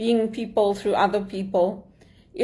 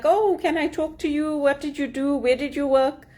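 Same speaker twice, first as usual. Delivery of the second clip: higher-pitched imitation of keen interest; eager interest; 5 semitones higher than usual. Networkers greeting a Canadian executive, imitated eager interest. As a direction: mimic people lighting up with sudden interest. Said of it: "people's faces would light up".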